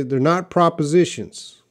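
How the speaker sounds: not hit firmly, contradicting; stress on not. Shown as a demonstration. Teacher corrects a student's misused word, stressing not. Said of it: "They're prepositions".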